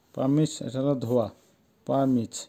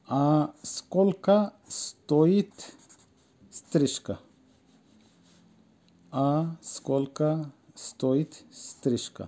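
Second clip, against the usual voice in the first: very slow, each word set apart; slow model phrase; 3 dB quieter, much slower than usual. Shown as a demonstration. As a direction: dictate it word by word for learners. (খুব ধীরে, শব্দে শব্দে)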